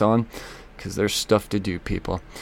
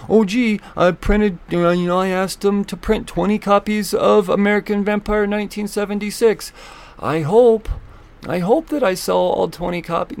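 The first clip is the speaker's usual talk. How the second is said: higher, mock hopeful shop owner voice; 8 semitones higher than usual. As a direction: play a fretting store owner, pitched up a bit.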